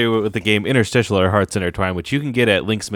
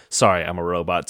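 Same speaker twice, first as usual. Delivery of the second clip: ordinary talk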